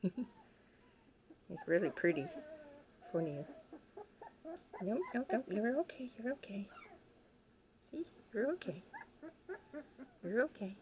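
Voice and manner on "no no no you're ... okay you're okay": soothing a pet. Real, not acted. Calming the nervous pet.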